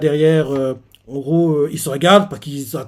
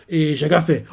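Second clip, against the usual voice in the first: ordinary delivery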